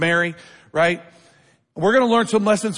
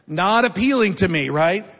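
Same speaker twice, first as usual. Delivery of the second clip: ordinary talk, nothing marked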